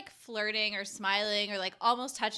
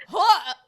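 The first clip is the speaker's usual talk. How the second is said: grunting heave; 7 semitones higher, 13 dB louder than usual. Retold or imitated. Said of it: "heaving themselves up on the side".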